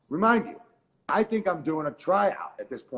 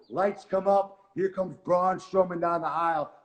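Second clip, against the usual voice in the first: ordinary talk, nothing marked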